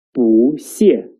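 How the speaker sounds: 不谢 repeated as a rise, then a fall; 不 in second tone. Shown as a demonstration. Teacher repeating for learners, 不谢 rising then falling. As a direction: glide 不 up into a falling 谢. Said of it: "will change into the second tone".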